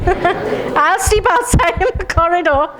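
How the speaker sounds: laughing, almost crying while speaking. Shown as a demonstration. Mock terror of the lizard, breaking into laughter. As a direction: laugh through the words. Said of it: "Do it with me".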